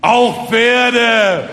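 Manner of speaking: loud, high, ringing proclamation; booming, utterly convinced boast; proud conviction. Acted. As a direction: declare it proudly from a full chest. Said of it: "sagte stolz im Brustton der Überzeugung".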